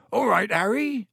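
booming friendly greeting; Hagrid voice. A narrator's reading.

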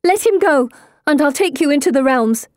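high, pressing appeal; urgent shouted plea. A narrator's reading. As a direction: call out a desperate bargain. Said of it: "I shout".

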